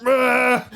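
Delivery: mock rage yell before flipping the bench; angry grunt. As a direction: burst out like a coach losing it. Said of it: "and then he's like".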